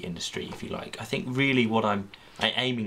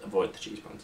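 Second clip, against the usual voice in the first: ordinary talk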